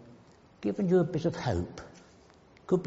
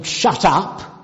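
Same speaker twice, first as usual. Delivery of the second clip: loud, higher mock order; sharply raised, stern acted command; stern silencing; 5 semitones higher, 10 dB louder than usual. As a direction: act out a crowd loudly silencing someone. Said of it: "the disciples sternly".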